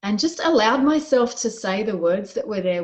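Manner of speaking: ordinary talk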